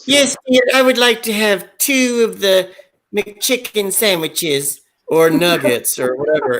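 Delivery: high, prim granny voice, slowing at the end; old-lady voice. Pretend. Ordering at the drive-thru in a high granny voice. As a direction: order like a fussy grandmother. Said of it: "So I would go like".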